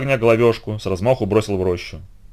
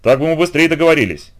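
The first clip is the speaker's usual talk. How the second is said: raised, forceful annoyance; fierce exclamation; 6 semitones higher, 4 dB louder than usual. A narrator's reading. Heracles rebukes the centaur for refusing a drink, fierce exclamation. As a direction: throw it out hotly. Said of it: "яростно крикнул Геракл".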